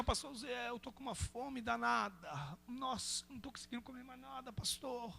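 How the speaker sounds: faint, hungry moan to the pastor; weak from hunger. Acted. Imitates hungry, weakened fasting brother.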